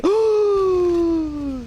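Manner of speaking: sharp high gasp of horror; shocked gasp. Real, not acted. Gamer gasping as his game breaks. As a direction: gasp as the round resets.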